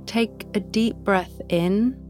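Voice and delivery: ordinary talk, nothing marked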